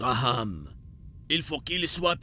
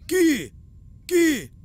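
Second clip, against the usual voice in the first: loud, high outraged yell; furious shout; 13 semitones higher, 6 dB louder than usual. Acted. Worshipper yelling at the crowd over the desecrated idols, furious shout. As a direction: bellow it at the crowd, demanding an answer.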